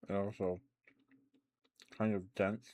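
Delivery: talking with a mouthful; chewing while speaking; mouth full. Real, not acted. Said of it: "I'm talking with my mouth full".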